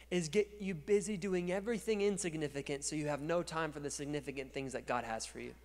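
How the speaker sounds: ordinary talk, nothing marked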